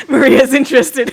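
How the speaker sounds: can't hold back the laugh. Real, not acted. GM cracking up while teasing the player, laughing.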